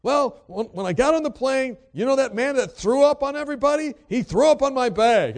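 high, loud indignant rant; imitating an outraged complaint at high pitch; freaked-out passenger. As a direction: protest in a hammed-up, upset voice. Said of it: "the lady just kinda freaked out".

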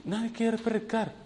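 hushed, puzzled inner voice; thinking to himself. Retold or imitated. Newcomer puzzled that nobody volunteers, thinking softly. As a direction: mutter the thought under your breath. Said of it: "yo mismo pensando".

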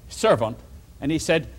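ordinary delivery

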